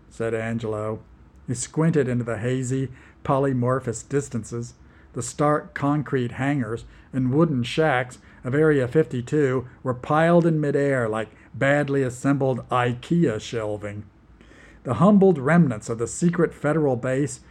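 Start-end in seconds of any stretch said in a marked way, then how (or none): none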